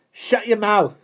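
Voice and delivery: loud, high, curt order; curt angry shout; short, rude retort. A narrator's reading. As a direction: cut him off angrily. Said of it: "said the boy shortly".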